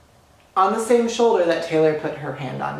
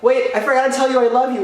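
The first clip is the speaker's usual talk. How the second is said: high, loud and fast, calling back to her; excited call; 9 semitones higher, 5 dB louder, faster than usual. Retold or imitated. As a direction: blurt it out breathless with excitement. Calling out to Taylor.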